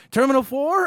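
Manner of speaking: high, loud questioning call; imitated calling out. Short Mexican guy hailing travelers, imitated loud call. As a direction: hail them loudly, pitch jumping up.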